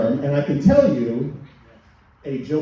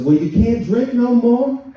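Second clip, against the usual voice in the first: mock doctor; 9 semitones higher, 4 dB louder than usual. Doctor quoted.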